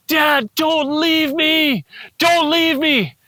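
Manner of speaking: very high, drawn-out imitation of a wailing child; child's desperate cry. Little boy crying after dad into the dark, imitated desperate yell. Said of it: "at the top of his lungs".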